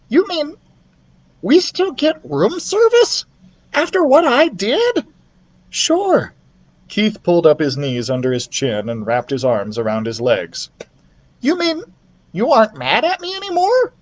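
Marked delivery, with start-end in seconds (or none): 0.1-5.0 amazed little mouse; high squeak, disbelieving; squeaky mouse. Acted. Ralph voiced. 5.7-6.3 put-on high easy boy; casual sure; lightly voiced boy; boy's voice. Keith voiced. 11.4-13.9 very high hopeful mouse; relieved little mouse asking; high squeak, hopeful; squeaky mouse. Acted. Ralph voiced.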